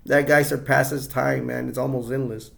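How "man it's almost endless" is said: ordinary talk, nothing marked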